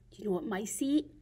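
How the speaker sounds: ordinary delivery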